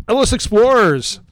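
burp while talking; words pushed out on a burp. Real, not acted. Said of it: "I had to burp".

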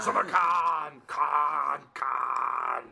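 long, loud drawn-out roar; hammed-up shout held long; movie-villain-name yell. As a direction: bellow the name, stretching it out slowly. Khan yell movie impression.

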